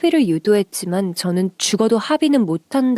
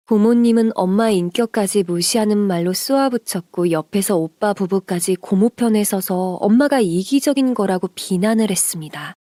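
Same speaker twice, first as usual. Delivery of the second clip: plain reading voice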